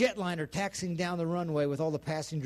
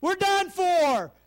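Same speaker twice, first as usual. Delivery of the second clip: high, raised cry, easing down; acted alarm, starting high and settling; imagined despairing leader; 8 semitones higher, 4 dB louder than usual. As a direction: call out doom to the crowd. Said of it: "if their leader would have said".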